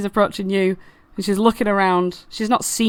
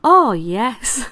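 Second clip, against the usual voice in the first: lightly voiced flattering flower; a touch of gushing sidekick; agreeing Daisy; 4 semitones higher than usual. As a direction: agree emphatically, a bit higher. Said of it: "And Daisy goes".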